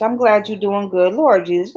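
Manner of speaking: usual speaking voice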